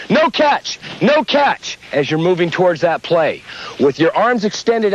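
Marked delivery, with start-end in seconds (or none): 0.1-1.8 high-pitched umpire's yell. Shown as a demonstration. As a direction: call it out for the field to hear.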